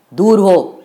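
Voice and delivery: loud harsh snap; snapping. Acted. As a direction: shove her off with the voice. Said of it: "खेकसला".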